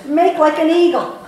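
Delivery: high, loud coaching yell; screaming. Retold or imitated. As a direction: yell it into her ear. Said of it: "screaming into my ear".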